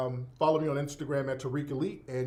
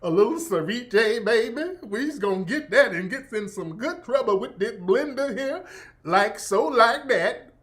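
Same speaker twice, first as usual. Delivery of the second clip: exaggerated Southern accent; 4 semitones higher than usual. Streamer mimicking a cooking influencer, exaggerated Southern accent. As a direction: lay the Southern accent on thick, like a TikTok cook. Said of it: "But that accent is very extra".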